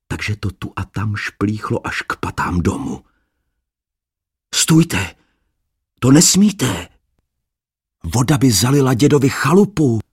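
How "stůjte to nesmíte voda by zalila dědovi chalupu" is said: high, loud urgent call; alarmed shout. Acted. Adam shouting at the dancers to save the cottage. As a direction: yell to stop them before the flood. Adam stops the dancing maidens.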